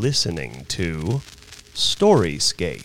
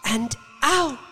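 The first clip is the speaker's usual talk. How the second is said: Missus Bellows; 14 semitones higher than usual. A narrator's reading. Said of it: "thought Missus Bellows".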